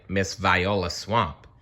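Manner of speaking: usual speaking voice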